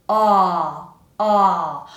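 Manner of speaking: loud, held "Ah" with the jaw dropped; open ah vowel. Shown as a demonstration. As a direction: drop the jaw and sustain "Ah". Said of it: "something like between an a and an o".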